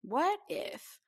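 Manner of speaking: ordinary talk, nothing marked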